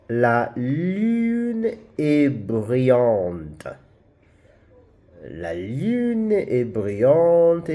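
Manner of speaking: slow model French, stretching the U of "lune"; French U demonstration. Shown as a demonstration. Teacher reading practice sentences for learners, slow drawn-out French. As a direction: say "la lune est brillante" very slowly, holding "lune". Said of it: "pronounce the U in French".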